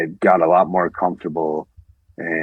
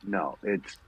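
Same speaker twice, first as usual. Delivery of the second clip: ordinary delivery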